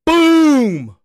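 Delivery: very loud, very high boom; excited shout. Real, not acted. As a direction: yell it out triumphantly. Reacting to the AI's answer.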